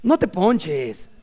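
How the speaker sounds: slow, pleading mock talk to a flat tire; lightly hammed-up plea. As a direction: drag it out like a silly plea. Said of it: "decirle a la llanta".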